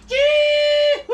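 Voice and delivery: slow, very high, loud whoop "Gee-hoo!"; big shout. Pretend. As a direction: stretch it into a huge joyful yell. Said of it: "one word, two syllables".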